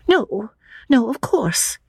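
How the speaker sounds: high-pitched, ashamed agreement. A narrator's reading. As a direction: back down in a high little voice. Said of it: "ashamed at the reference to Cecil".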